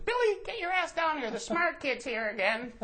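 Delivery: very high mock mom yell. Neighbor mom calls her son downstairs, high mock yell. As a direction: shriek up for Billy, hammed-up.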